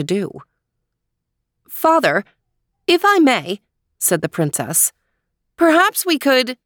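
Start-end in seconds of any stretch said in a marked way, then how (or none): 1.7-3.6 polite princess. Acted. Princess voiced in story. 5.6-6.6 polite princess. Acted. Princess voiced in story.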